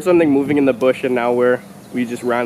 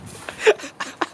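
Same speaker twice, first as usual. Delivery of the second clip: laughing; 4 dB quieter than usual. Real, not acted. Friend misses the hoop.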